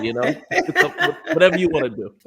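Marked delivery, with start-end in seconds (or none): 0.5-2.3 said through a laugh; chuckling while joking along with the guest. Real, not acted.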